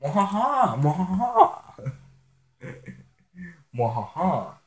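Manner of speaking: hammy villain cackle; big mock evil laugh.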